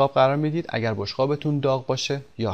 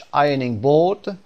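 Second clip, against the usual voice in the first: British "ironing board", both R sounds dropped; slow, careful British English demonstration; British pronunciation; 2 semitones higher, 4 dB louder, much slower than usual. Shown as a demonstration. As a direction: say it British, "eye-uh-ning bawd". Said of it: "تلفظ اول بریتیش".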